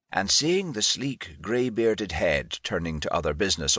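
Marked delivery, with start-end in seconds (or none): none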